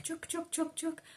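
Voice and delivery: high, soft clucking chuk chuk to coax a hen; chicken call. Retold or imitated. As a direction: softly cluck like calling a chicken. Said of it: "the English speakers were like".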